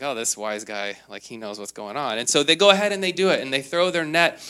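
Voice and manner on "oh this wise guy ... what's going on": mock grumble. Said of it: "there was a grumble like".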